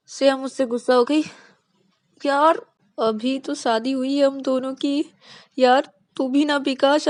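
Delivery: high, loud frantic fretting; a scared husband frets to his friend; panicked whining. Acted. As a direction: spiral into helpless panic. Panicked husband after wife leaves.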